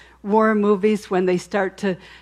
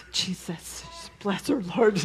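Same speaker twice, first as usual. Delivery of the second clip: breathy, hushed prayer under the breath; husband's hushed prayer; 7 dB quieter than usual. Retold or imitated. Husband praying quietly for his wailing wife, hushed. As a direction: pray softly over her while driving.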